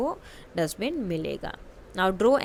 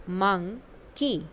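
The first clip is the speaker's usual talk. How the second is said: "Monkey" stretched out slowly, syllable by syllable; slow model word; much slower than usual. Shown as a demonstration. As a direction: say it slowly so kids can copy. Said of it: "listen to your teacher and say the following word". (धीरे-धीरे खींचकर "monkey")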